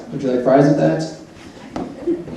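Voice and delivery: slow, soft, deadpan counter-clerk question; mock fast-food cashier. Narrator mocking the rushed darshan line, deadpan fast-food clerk voice. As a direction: drawl it like a bored drive-through worker.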